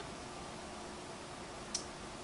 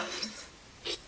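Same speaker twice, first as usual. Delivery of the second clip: rough throaty burp; loud burp; 15 dB quieter than usual. Real, not acted. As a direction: let out a gross burp. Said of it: "That sounded like a Rick burp".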